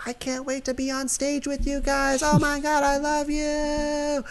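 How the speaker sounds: high-pitched adoring fan voice; gushing audience fan. Pretend. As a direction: squeal like a starstruck audience member. Host voices the studio audience.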